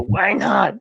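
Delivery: high, agitated wrestler voice; mock freak-out, pitch jumping up; Macho Man freaking out. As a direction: protest in a high put-on voice. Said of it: "he's, like, freaking out".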